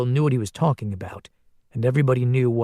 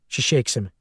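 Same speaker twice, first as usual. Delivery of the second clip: ordinary delivery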